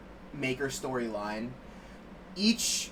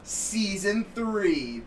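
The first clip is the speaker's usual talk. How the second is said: slow emphasis; 5 semitones higher, much slower than usual. Acted.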